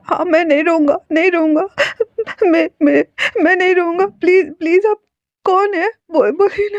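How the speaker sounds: high, teary promises, stammering; crying voice. Acted. Caller told to stop crying, high tearful stammer. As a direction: fight back tears while begging. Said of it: "रोना बंद करो".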